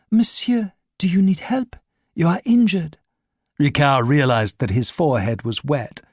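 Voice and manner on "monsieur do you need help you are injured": shop saleswoman. A narrator's reading. Saleswoman to bleeding Ricard, high concerned voice. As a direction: voice her concerned and higher. Perfume saleswoman helping injured man.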